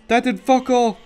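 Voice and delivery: ordinary delivery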